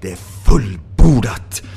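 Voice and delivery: a hint of Christ's final cry; solemn Jesus line. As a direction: proclaim it like the last word on the cross. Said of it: "när han sa".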